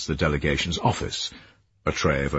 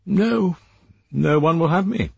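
lightly voiced woman; 12 semitones higher, 3 dB louder than usual. Joan, amused.

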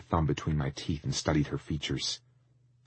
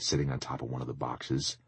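usual speaking voice

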